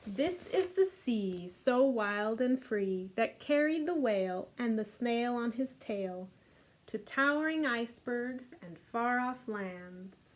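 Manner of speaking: lively storybook read-aloud; reading to children. A narrator's reading. Teacher reading The Snail and the Whale to kids. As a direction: read it warmly to a group of little ones. Reads picture book aloud.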